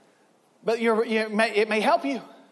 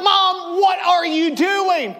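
put-on startled child's cry; 6 semitones higher, 3 dB louder, slower than usual. Kid startled by mother screaming.

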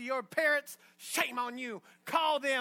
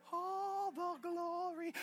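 breathy, higher, softer mock glory; mock rapture; 7 semitones higher, 7 dB quieter, much slower than usual. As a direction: swoon over the glory in mockery. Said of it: "so you can say".

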